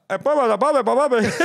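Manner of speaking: put-on high, loud Dominican-accented babble breaking into a laugh; laughing Dominican accent impression. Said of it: "Why are you so fucking good at accents".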